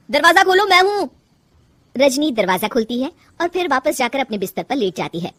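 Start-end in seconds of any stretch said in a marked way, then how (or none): 0.1-1.1 raised, high-pitched call; loud call to someone in another room; calling through a door. Acted. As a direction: call out to be let in. Husband calls through the closed door.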